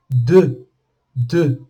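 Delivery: "de" as deux, E through rounded lips; French pouted vowel. Shown as a demonstration. Teacher showing the word for two, pouted pronunciation demo. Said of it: "Olha o biquinho".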